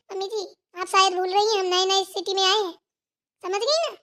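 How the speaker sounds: ordinary talk, nothing marked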